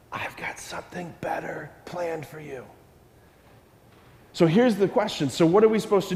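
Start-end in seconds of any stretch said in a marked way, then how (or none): 0.1-2.6 whispered secret promise. Acted. God telling the child a better plan, whispered. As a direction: lean in and breathe it like a secret.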